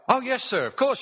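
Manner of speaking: a mock teller's high eager reply. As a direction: gush it out like an eager young teller. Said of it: "she said".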